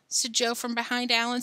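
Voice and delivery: ordinary delivery, nothing marked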